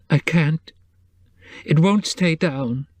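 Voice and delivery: a touch of a weak whimper. Acted. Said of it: "she whimpered".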